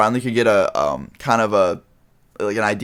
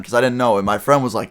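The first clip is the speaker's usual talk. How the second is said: ordinary talk, nothing marked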